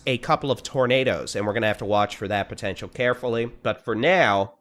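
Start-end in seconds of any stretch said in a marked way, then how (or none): 3.4-4.6 now said slowly and leaned on; stress on now. Real, not acted. Forecaster warning viewers that tornadoes aren't an issue yet, stressing "now" slowly. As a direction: drag out "now" slowly, pressing the word. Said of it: "and I emphasize the word now".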